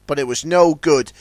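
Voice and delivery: ordinary delivery, nothing marked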